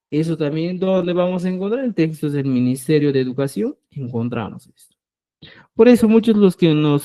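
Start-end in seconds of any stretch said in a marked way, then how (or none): none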